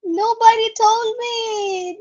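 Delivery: drawn-out, high, loud disbelief; loud dismay. Real, not acted. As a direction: wail it slowly, appalled at the discovery. Discovers she was muted.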